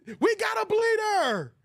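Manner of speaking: high, drawn-out mock holler; mock movie yell. Streamer quoting a movie line, high mock yell. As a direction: call it out like an imitated alarm.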